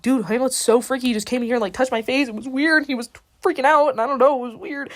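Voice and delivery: freaked-out retelling. Retold or imitated. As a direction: spill it like a rattled friend. Ophelia gossips, freaked out by Hamlet.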